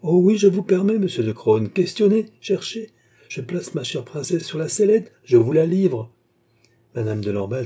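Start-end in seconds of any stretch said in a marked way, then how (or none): 0.0-6.1 high, loud and excited; eager permission. A narrator's reading. King handing princess over for questioning, excited. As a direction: urge the questioner on, pitched up.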